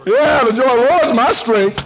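high, loud hammed-up piety; mock pious boast. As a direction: overplay the cheerful believer. Continues mocking churchgoer.

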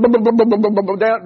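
rhythmic mock thumping of a bare wheel; bumping noise. Preacher imitating his truck thumping down the road on the wheel, rhythmic booms. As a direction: chant the booms like a rim hitting the road.

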